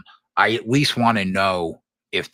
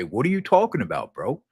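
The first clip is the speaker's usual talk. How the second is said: mocking doubter; 9 semitones higher, 3 dB louder than usual. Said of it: "everybody's gonna say".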